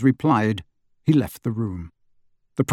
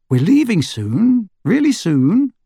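higher, louder, eager promise; eager to owl; 9 semitones higher, 4 dB louder, slower than usual. A narrator's reading. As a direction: reassure the caged owl eagerly. Harry tells his owl.

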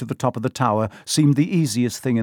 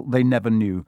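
usual speaking voice

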